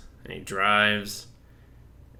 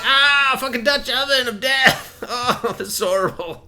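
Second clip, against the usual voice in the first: much higher, loud gagging outburst, laughing in it; disgusted shout; 14 semitones higher, 5 dB louder than usual. Retold or imitated. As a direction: shriek about the stench. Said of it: "She's like".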